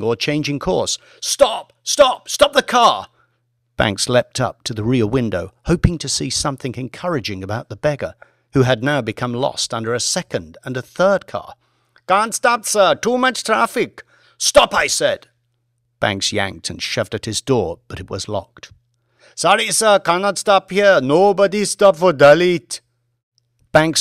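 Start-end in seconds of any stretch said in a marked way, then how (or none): 1.2-3.1 high, loud, desperate shouts; shouting. Acted. Banks shouting at the driver to stop the car, desperate. Banks sees the beggar run over. 12.1-14.0 put-on much higher, apologetic Indian-accented driver. Driver refuses to stop. 14.4-15.3 louder, higher firm command. Acted. Banks ordering the driver to stop, firm and louder. 19.3-22.8 put-on much higher, apologetic Indian-accented driver. Driver refuses again.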